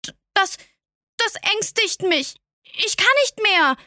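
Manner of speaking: put-on a blushing child stumbling over his words; stammering prince. A narrator's reading. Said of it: "stammelte der kleine Prinz und errötete".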